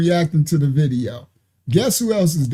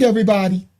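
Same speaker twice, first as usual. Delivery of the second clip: normal talking voice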